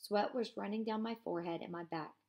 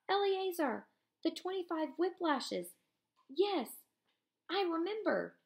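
put-on higher-pitched voice for the boy; Juliek answering; 9 semitones higher, 4 dB louder than usual. Juliek answering Eliezer, higher voiced. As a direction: answer weakly from under the bodies.

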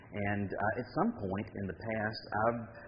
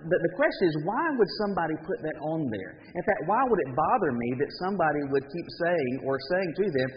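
louder, rising preacher's exhortation; preaching; 3 semitones higher, 3 dB louder than usual. Real, not acted. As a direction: press the point home to the congregation.

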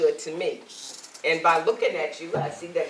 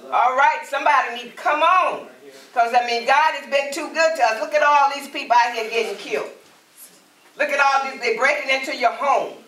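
preaching; 6 semitones higher, 3 dB louder than usual. Acted. Preaching at full force.

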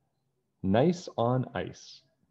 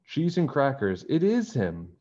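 higher, excited exclamation; exclaiming; 6 semitones higher than usual. Acted. George spotting the snowman, exclaiming excitedly. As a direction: blurt out the surprise. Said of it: "George exclaimed".

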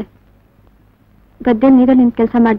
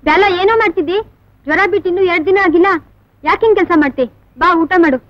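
high-pitched scolding call to come and eat; 7 semitones higher than usual. Acted. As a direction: call across to someone working too soon after illness. Calling a worker over to eat.

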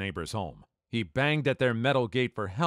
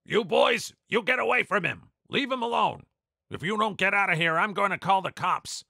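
much higher and louder stern yell; screaming at the bullies; 10 semitones higher, 4 dB louder than usual. Retold or imitated. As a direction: chase the boys off with a yell. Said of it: "starts screaming at the bullies".

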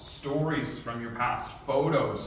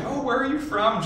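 curious guest; 13 semitones higher, 5 dB louder than usual. Retold or imitated. Voicing a curious table guest.

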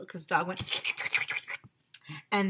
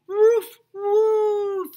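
loud, high imitated woofs; excited dog bark; 14 semitones higher, 9 dB louder than usual.

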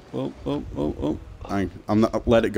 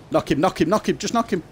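high, rapid-fire repeated command; urgent urging; 10 semitones higher, faster than usual. Real, not acted. Player urging teammate to knock him, urgent and high. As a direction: urge a teammate on, pitch climbing.

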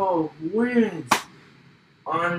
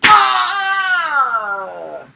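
battle cry; 11 semitones higher, 9 dB louder than usual. Pretend. Battle cry in fight game.

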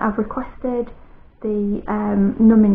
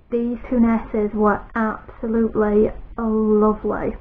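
ordinary talk, nothing marked

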